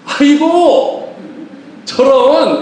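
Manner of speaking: drawn-out, high-pitched fake dismay; gleeful mock sympathy. Neighbour hearing the cousin lost the rice field, gleeful mock sympathy. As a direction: stretch the commiseration out high, secretly delighted. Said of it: "이러면서 우리 신나거든요".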